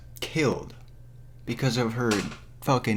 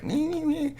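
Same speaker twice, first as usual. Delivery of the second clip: very high, whiny mimicking noises; mock her with squeaky nonsense; mocking whine. Mocks the character's whining.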